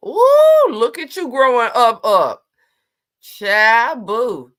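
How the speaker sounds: high squealing "Ooh" then loud cheering; loud, high delighted praise; excited squeal. Real, not acted. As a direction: gush over her like a proud aunt. Cheering a viewer's new drink.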